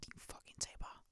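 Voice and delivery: hushed, breathy retelling of a nasty message; whispered mock threat. Whispering her old angry email to the ex. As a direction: whisper the insult like a secret threat.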